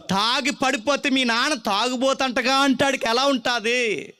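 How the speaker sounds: put-on preacher pressing the congregation on a lonely child; full-force preaching. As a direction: preach it out at the top of the voice.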